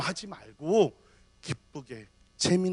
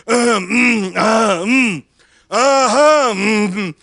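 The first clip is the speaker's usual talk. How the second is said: nervous humming; 10 dB louder, much slower than usual. Retold or imitated. Pastor in the elevator humming to reassure the woman, loud. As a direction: hum on purpose to show you are harmless. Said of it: "계속 소리를 내드려".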